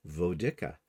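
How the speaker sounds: Vodica as "vo-DICK-a"; wrong pronunciation. Shown as a demonstration. Host admitting her old mistake, Vodica as "vo-DICK-a". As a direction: say Vodica with a short i in the middle. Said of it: "I have been saying it wrong".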